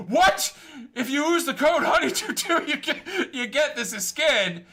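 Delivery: mock-burst out with the promo code; hidden lover popping out. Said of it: "this guy comes out from under a curtain going".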